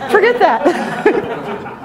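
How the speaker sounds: high, drawn-out words through laughter; laughing insistence. Real, not acted. Dismissing the fumes to her sister, laughing. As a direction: insist on it while cracking up.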